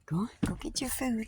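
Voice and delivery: soft whispered pet talk; whispered coaxing. Real, not acted. To her birds, whispering them down to eat. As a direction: whisper the birds toward their food.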